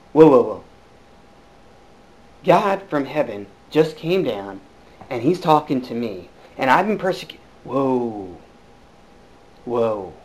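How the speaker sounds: low, hushed, slowed awe; stunned whoa. Acted. Saul to himself after hearing God: hushed stunned awe. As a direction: let the shock sink in quietly. Saul's stunned inner reaction.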